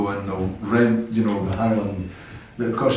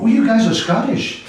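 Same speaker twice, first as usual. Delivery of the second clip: high, bright mock American clerk, much higher and louder; imitating hotel clerk; 12 semitones higher, 4 dB louder than usual. Hotel clerk greeting the Scottish band, mock American. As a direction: chirp it like an excited hotel receptionist. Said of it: "we check into the hotel and go".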